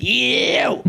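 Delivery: high, disgusted crowd "Ew"; mock crowd groan. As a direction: sneer it like a whole room recoiling. Crowd reacts to Fenian cheer.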